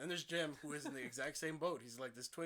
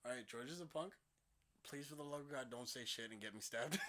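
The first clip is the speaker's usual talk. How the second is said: low, hushed thinking to himself; quiet, sinking private plea; inner thought; 5 semitones lower, 6 dB quieter than usual. Retold or imitated. Narrator silently pleading with his friend, low and quiet. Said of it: "I'm thinking in my head".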